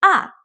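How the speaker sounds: "ah" falling sharply from high; fourth tone ah. Shown as a demonstration. Teacher demonstrates falling fourth tone. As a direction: drop "ah" straight down. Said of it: "it goes straight down".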